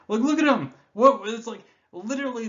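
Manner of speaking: sudden high, loud burst of glee; excited squeal. Real, not acted. Collector thrilled by card art, high excited burst.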